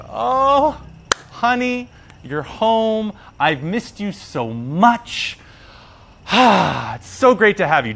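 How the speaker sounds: high, gushing welcome for a husband; hammed-up loving wife greeting; delighted wife. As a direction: coo over the returning husband, drawing out the words. Wife greets her returning husband.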